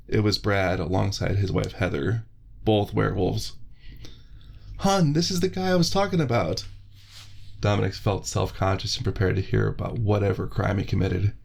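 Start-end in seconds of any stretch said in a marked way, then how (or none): 4.8-6.7 much higher, cheery friendly guy; Brad's voice. Acted. Brad greeting Dominic from behind, high and cheery. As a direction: call out to a buddy you spotted, pitched up.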